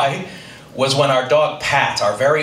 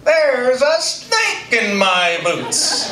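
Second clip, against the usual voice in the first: slow, high talking-doll voice; drawn-out cowboy toy catchphrase, pitched way up; Woody; 8 semitones higher, much slower than usual. Retold or imitated. Same voice heard in the night, slow high doll drawl. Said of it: "I hear the same voice say".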